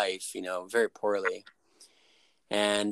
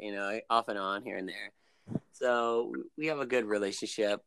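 ordinary talk, nothing marked

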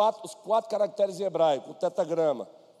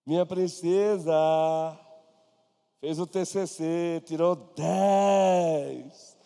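proud parent; 3 semitones lower, much slower than usual. Retold or imitated. Proud parent voiced in story.

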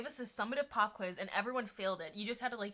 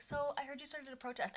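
imitating him, soft and a bit higher; mellow; 4 semitones higher, 8 dB quieter than usual. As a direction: softly mimic a mellow accusation. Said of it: "the most mellow, like, sentence".

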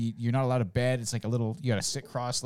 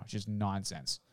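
usual speaking voice

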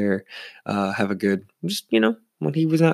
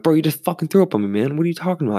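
higher, incredulous confrontation; mildly outraged, pitched up; retold indignant protest; 7 semitones higher, faster than usual. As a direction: act out calling out the offender. Acted quarrel with the drunk guy.

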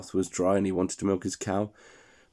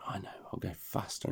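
breathy whisper, much softer; whispered thought; 13 dB quieter than usual. Acted. Hans deciding on the horse to go faster, whispered thought. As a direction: say it as a secret thought to himself.

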